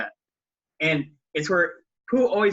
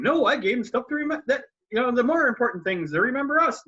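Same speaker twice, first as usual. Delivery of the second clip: high, quick bouncy reply; a hint of an excited tiger; Tigger explaining; 8 semitones higher, faster than usual. Tigger answers Pooh, high quick voice.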